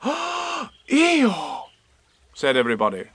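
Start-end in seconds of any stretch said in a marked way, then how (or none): 0.0-1.7 high, drawn-out shocked cry; shocked chorus. Acted. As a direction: gasp his name in alarm. Everybody exclaims.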